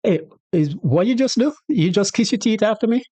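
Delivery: high, outraged parent's disbelief; shocked parent. Retold or imitated. Shocked parent scolding a child who kissed teeth. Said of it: "a shocked response".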